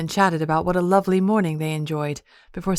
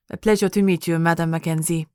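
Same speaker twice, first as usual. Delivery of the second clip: put-on thick French accent. French dressmaker greeting the new client, thick French accent. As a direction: lay on a French accent, gracious and polite. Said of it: "the woman answered in a thick French accent".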